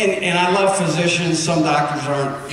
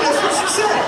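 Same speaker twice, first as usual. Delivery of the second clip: laughing. Real, not acted. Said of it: "I'm not kidding".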